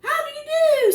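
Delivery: high, loud proud fish voice; proud Barry. Acted. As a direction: puff up and greet grandly. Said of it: "said Barry, proudly".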